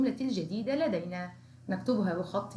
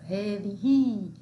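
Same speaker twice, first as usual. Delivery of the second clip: slow teacher modelling; slow drawn-out model word; 4 dB louder, much slower than usual. Shown as a demonstration. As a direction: stretch each sound slowly. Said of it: "الذال لدي-،".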